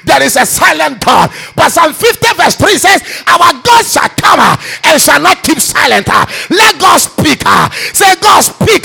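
shouted fiery prayer, every line hammered; full-force preaching. Acted. Preacher leading the congregation, shouting prayer at full force. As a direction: pray over the church at the top of your lungs.

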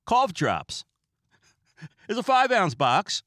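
booming high prize announcer voice; game show announcer. Retold or imitated. Imitating a game show host unveiling a prize. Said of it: "he would be like".

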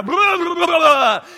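loud high mock growl jumping out; mock monster roar. A preacher mimics the guy who jumped out, mock roar. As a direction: roar it like a haunted-house scare actor.

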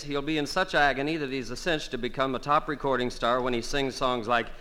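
ordinary talk

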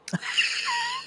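short high-pitched laugh. Real, not acted. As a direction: let out a high giggle. Laughs at own hype.

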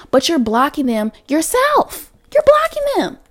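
high-pitched, exasperated tough love; animated pleading that peaks in a squeal; emphatic self pep talk. Real, not acted. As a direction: scold yourself into believing, pitch climbing. Pep-talking herself about money blocks.

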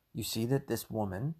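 ordinary talk, nothing marked

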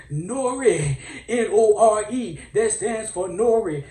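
loud, high mock-sermon shout; preacher voice. Comic preacher introducing Nore as a Virgo, loud rousing shout. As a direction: proclaim it like a preacher rousing the crowd. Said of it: "let me get my preacher voice".

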